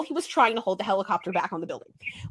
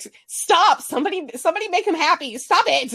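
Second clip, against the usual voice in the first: anguished plea; 9 semitones higher than usual. Retold or imitated. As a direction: yell it like it hurts to watch. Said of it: "And I'm like".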